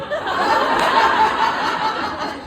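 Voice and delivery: breathy unvoiced laugh; airy laugh. Real, not acted. Comic laughing at his church trick. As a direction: laugh out at the memory.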